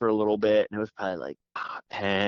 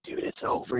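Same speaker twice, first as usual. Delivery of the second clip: hushed whisper, barely voiced; 7 dB quieter than usual. Hunter muttering to himself while waiting on the turkey, whispered. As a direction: breathe it out under your breath. Quiet hunter alone in woods.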